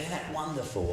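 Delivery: a touch of excitement, lifted in pitch; excited wife. Retold or imitated. Wife reacting to her husband's news, gently excited. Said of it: "she's all excited".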